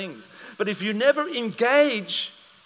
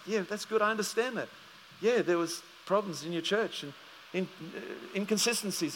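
patient soft reply; 9 dB quieter than usual. Retold or imitated. His soft patient reply retold.

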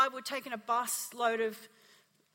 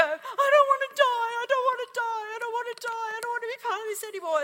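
high, loud repeated wail; imitated desperate pleading; 10 semitones higher, 9 dB louder than usual. Kids begging out of the suicide pact, imitated panicked wail. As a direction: cry it out like terrified kids, easing off in volume.

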